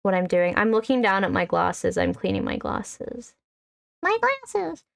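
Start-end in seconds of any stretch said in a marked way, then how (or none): 4.0-4.8 high squeaky exclamation; surprised squeal. Real, not acted. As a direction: yelp it out in surprise. Sudden squeal about glasses.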